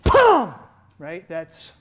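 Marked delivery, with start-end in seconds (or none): 0.0-0.5 high, loud thump for the strike; striking demo sound effect; shouted hit sound. Shown as a demonstration. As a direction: punch the sound out.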